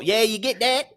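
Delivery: put-on high, loud Southern accent. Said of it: "a southern accent".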